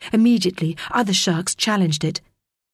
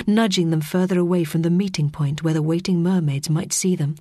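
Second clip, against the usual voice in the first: plain reading voice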